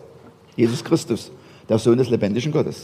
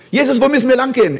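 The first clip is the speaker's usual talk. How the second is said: 1 eager questioner; 10 semitones higher, 5 dB louder, faster than usual. Pretend. Acting out disciple questioning Jesus.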